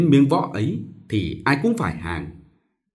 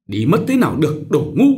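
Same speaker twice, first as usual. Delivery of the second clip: contemptuous scolding; 4 semitones higher, 4 dB louder than usual. Acted. Mistress insults girl.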